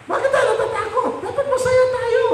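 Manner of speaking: high-pitched exhortation; impassioned pulpit appeal; full-force preaching. Acted. Exhorts the congregation.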